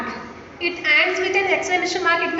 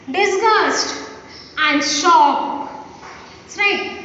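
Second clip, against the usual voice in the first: ordinary talk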